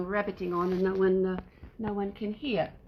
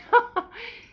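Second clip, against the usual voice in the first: sudden high laugh, louder; high burst of laughter; 7 dB louder than usual. Real, not acted. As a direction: giggle at the cat's funny tail. Laughs at funny tail.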